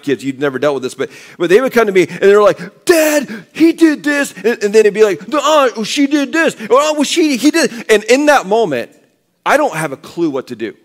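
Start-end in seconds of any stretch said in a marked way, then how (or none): 2.8-4.3 put-on high, whiny child's voice; tattling kid. Child tattling to dad about his brother, high whine. 5.3-7.9 put-on high, whiny child's voice; tattling kid. Child tattling to dad about his brother, high whine. Sibling blaming back to dad.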